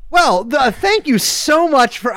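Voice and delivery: lightly attempted radio voice, drawn out. Podcast guest thanks the host, attempting a radio voice. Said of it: "I can't do the fucking Riley Radio voice".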